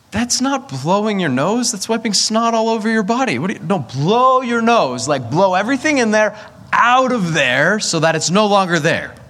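high, insistent frustrated scolding; exasperated scolding. Retold or imitated. Tired father scolding his sick son at night, exasperated. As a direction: lose patience with a kid who won't blow his nose.

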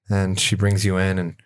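normal talking voice

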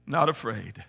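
soft, low, intimate sermon voice over music; hushed preaching. Acted. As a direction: bring it down and speak close to the heart.

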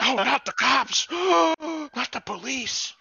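high, slow gasping mock horror; hammed-up scandalized gasp, drawn out and high; mock pearl clutching. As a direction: clutch your pearls in fake shock at the cops. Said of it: "You're like".

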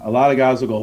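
normal talking voice

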